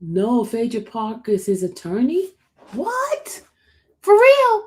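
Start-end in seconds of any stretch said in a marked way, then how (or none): 2.7-4.6 high, sharp shocked questions; jolted by the news; stunned disbelief. Real, not acted. Hearing who the attorney is, high and stunned.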